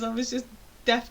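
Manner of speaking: usual speaking voice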